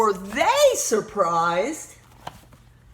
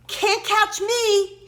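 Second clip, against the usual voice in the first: high, slow, singsong taunt; teasing shout; 8 semitones higher, much slower than usual. A narrator's reading. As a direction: tease the dragons, stretching each word. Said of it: "he shouted as he turned the corner".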